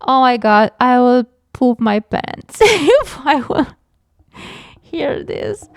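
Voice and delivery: nervous laughing. Real, not acted. Nervous giggles at scary story.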